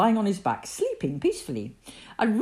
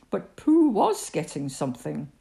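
plain reading voice

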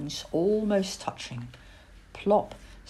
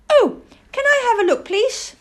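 high, loud, quick little owl voice; piping child-owl plea; eager owlet; 9 dB louder than usual. Acted. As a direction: beg excitedly for a turn. Plop begs to use telescope.

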